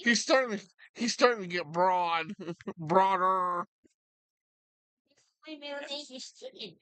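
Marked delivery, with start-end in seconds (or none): 5.5-6.8 high, fast, soft put-on dog voice; voicing the dog. Owner speaks for his dog named after chicken, high and quick. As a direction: squeak it quickly as the proud puppy. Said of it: "he says his middle name is Chicken".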